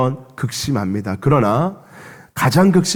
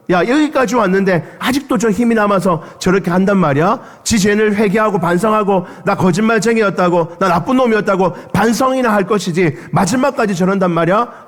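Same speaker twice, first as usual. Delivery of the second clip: higher, scornful jeering; mocking taunt; 6 semitones higher than usual. A bystander taunting Jesus on the cross, higher and scornful. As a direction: sneer at the dying man. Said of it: "예수님을 조롱하는".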